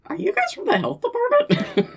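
very high, incredulous acted voice; hammed-up high-pitched puzzlement; bewildered question. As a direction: squeak out a baffled guess. Acted bewildered guess after a pause.

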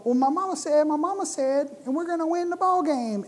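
a hint of the Water Boy; mild Water Boy impression; lightly voiced Water Boy. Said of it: "the Water Boy character would be up there going".

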